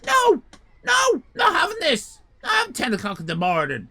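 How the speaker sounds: high, loud indignant protest; indignant refusal. Retold or imitated. Customer refusing lasagna at ten in the morning. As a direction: refuse in outraged disbelief. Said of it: "And I said".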